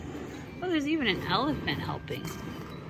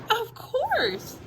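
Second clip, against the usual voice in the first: much higher, prim and sure; prim Emily; 13 semitones higher than usual. Acted. Mother reading, Emily answers Jack primly. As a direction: sniff it out primly, high. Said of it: "said Emily".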